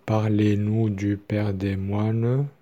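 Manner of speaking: slowly, syllable by syllable; measured teaching pace; slow syllable-timed demo. Shown as a demonstration. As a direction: space out each syllable evenly. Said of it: "Par exemple".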